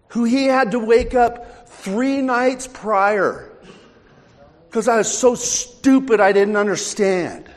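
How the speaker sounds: high, loud preacher's testimony; impassioned preaching. Real, not acted. Preacher confessing his own slowness to the congregation, impassioned preaching.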